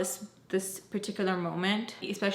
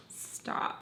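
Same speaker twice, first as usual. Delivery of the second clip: softly whispered, unvoiced stop; 11 dB quieter than usual. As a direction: breathe the word out quietly. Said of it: "I was like".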